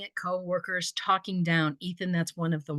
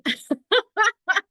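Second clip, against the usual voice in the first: high laugh; 7 dB louder than usual. Real, not acted. Laughs at own loud habit.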